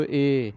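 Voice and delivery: "ए" held as a long steady ay; long vowel sound. Shown as a demonstration. Teacher showing the letter's sound, "ए" held long. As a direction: stretch "ए" out evenly. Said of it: "इन आवाज़ों को".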